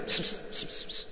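whispered "بس بس بس" like calling a cat; mock hissing call. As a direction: hiss "بس" softly through the teeth. Young man hissing at girls. (همس "بس بس" كأنه ينادي قطة)

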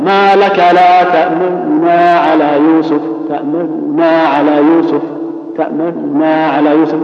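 slow, level recitation with a fleeting first noon in تأمننا; ikhtilas recitation. Shown as a demonstration. Teacher modelling the verse for students, ikhtilas in تأمننا. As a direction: recite measuredly, snatching the vowel inside تأمننا. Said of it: "في النطق هكذا".

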